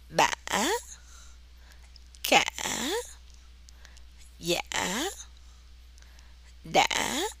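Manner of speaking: "Bả, Cả, Dạ, Đã" each split by a short catch, then rising; questioning rise with a short break inside "Bả. Cả. Dạ. Đã."; broken rising tone. Shown as a demonstration. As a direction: break the vowel briefly, then lift it on "A ngã a". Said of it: "but there is a short break".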